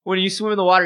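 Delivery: ordinary talk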